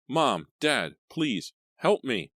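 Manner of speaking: high loud panicked cries; frantic screams. A narrator's reading. Leah runs down to her parents, frantic screaming. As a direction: call for help in terror. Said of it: "running frantically down the stairs".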